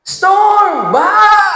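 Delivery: high, loud alarmed yell; shouting. Acted. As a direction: shout the false alarm to cause chaos. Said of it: "Sumigaw yung congressman". (malakas na sigaw na may takot)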